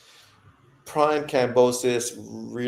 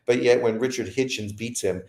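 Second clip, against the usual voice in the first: ordinary talk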